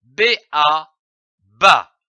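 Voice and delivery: put-on teacher blending letters for a child; careful spelling then a firm blend; sounding out. Shown as a demonstration. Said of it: "Nous avons vu la syllabe ba".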